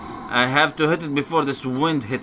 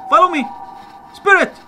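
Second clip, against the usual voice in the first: very high, loud summons; high, forceful call across a distance; 8 dB louder, faster than usual. Real, not acted. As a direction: shout for it to follow. Calling the spirit over.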